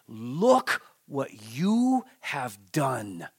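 very slow, weighted blame; Job's accusation. Acted. Job points to God, in slow, weighty accusation. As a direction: draw out each word as a pointed charge. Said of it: "Job points to God".

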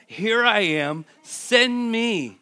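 loud, high call; bold declaration. Shown as a demonstration. Preacher proclaiming Isaiah's answer to the congregation, loud. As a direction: cry it out like a volunteer. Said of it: "What did he say?".

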